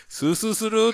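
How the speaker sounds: very high-pitched Hakata question-like "sū sū suru"; Hakata dialect. Shown as a demonstration. Showing another local draught phrase, high Hakata delivery. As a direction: voice a local's remark, pitch leaping up. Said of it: "っていうのもあるけどね".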